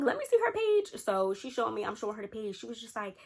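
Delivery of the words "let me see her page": higher, eager demand; nosy demand. Retold or imitated. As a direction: grab the phone, insistent. Friend demands to see page.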